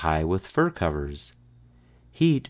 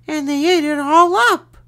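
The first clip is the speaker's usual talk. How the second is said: high squeaky voice; 8 dB louder than usual. A narrator's reading. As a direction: squeak it like a small cub. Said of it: "in her high, squeaky voice".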